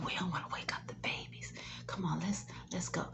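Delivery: quick, hushed whisper so nobody wakes; don't wake the babies, whisper it fast; whispering to mom so the babies keep sleeping. Shown as a demonstration.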